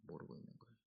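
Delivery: unvoiced whispered echo of 모르고 있는 거예요; whispered repeat. Real, not acted. Preacher repeats line in whisper.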